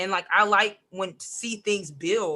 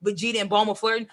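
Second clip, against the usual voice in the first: usual speaking voice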